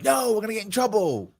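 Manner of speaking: high-pitched imitation of a reluctant roommate; worried protest. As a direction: act out a friend chickening out. Roommate balks at sneaking out.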